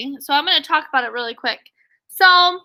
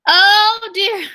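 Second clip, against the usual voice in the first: higher, louder oh dear in mock dismay; dramatic oh dear; 6 semitones higher, 5 dB louder than usual. Teacher reacts to the sad dog, higher and louder. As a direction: gasp it out like a storyteller. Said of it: "I just said that".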